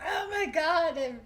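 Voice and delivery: high, loud whiny overreaction; dramatic whiny daughter. Retold or imitated. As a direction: ham up the drama queen. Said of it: "She's like".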